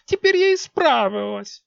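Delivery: very high, loud, slow sulk; pouting young queen; offended queen. A narrator's reading. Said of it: "обиделась Виолетта".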